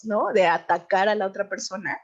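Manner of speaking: ordinary talk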